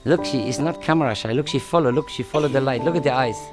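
ordinary talk, nothing marked

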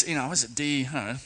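normal talking voice

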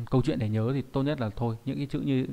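normal talking voice

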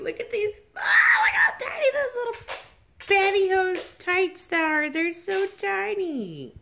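high squealing gush over tiny tights. Real, not acted. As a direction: squeal with delight at how tiny they are. Gushing over tiny baby tights.

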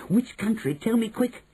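high, eager and pushy; impatient cry. A narrator's reading. Boy impatient for the country's name, eager cry. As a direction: hurry her along. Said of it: "I cried impatiently".

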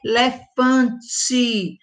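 "Elefante" drawn out slowly for the class; slow syllables. Shown as a demonstration. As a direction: sound out "Elefante" gently. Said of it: "ela tem esse som".

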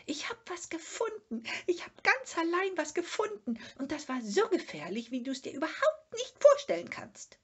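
high, fast, breathless creature voice; a small animal bursting with his discovery; excited Sniff. Acted. Sniff tells Moomin of his find, high and excited.

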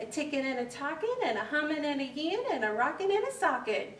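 fast bouncing patter; rhythmic patter. Acted. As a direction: rattle it off like dance steps. Rhythmic dance verse.

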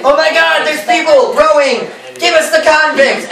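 high-pitched mock panic; mock alarm. The pursuers shouting at the rowers, mock alarm. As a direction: cry it out in exaggerated alarm.

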